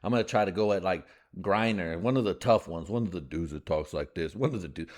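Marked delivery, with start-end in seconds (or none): none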